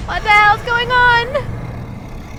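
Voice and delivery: high, slow panicked cry; terrified scream. A narrator's reading. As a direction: scream it in sheer terror. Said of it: "she screamed".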